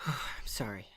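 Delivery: sigh, then a whispered apology through tears; tearful whisper. Real, not acted. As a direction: barely get the words out, moved. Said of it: "I'm sorry for getting emotional".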